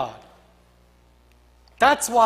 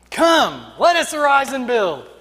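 loud, higher-pitched call to action; rallying shout; 4 semitones higher, 4 dB louder than usual. Acted. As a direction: answer as a crowd ready to work. People answer Nehemiah's call.

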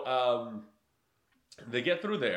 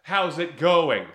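slow, louder yelled greeting over gunfire; a pirate shouting a cheery hello; 2 semitones higher, 8 dB louder, much slower than usual. Retold or imitated. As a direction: call it out slow and big across the road. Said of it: "and he yells".

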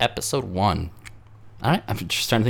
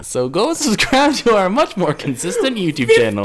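laughing; 11 semitones higher, 6 dB louder than usual. Real, not acted. Laughing through plug.

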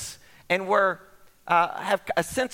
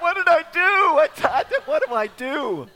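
higher and louder, panicked self-questioning; 12 semitones higher, 6 dB louder than usual. Retold or imitated. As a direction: replay the horror of a blunder. Panic after patting celebrity.

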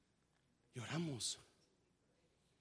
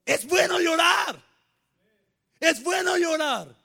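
slow, high shouted declaration; 10 semitones higher, 7 dB louder than usual. Real, not acted. Pastor crying out to the church that crying is good, slow shout. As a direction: proclaim it loud and slow.

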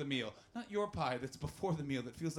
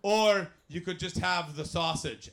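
loud, slow counter-server impression; imitated takeaway lady, slow and emphatic; Scottish takeaway lady; 4 semitones higher, 9 dB louder, slower than usual. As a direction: spell it out loudly for a difficult customer. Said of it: "pointed at the battered sausage and they went".

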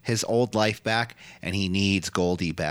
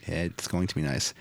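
normal talking voice

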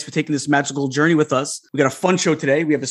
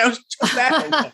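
exasperated; 8 semitones higher, much slower than usual. Retold or imitated. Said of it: "I was like".

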